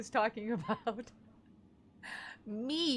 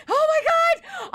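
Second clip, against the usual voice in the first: much higher slow exclamation; loud high oh my God; exclaiming; 12 dB louder than usual. Real, not acted. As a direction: cry out at yourself. Exasperated outburst at herself.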